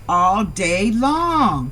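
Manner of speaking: very slow, stretched words; drawn out. Real, not acted. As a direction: stretch each word out. Drawn-out ending.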